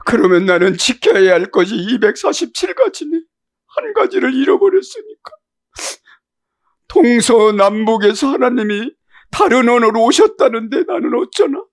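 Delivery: high, loud, crying preacher's shout; preaching at full force. Acted. Preacher crying out over losing one commandment. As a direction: cry out to the congregation in anguish.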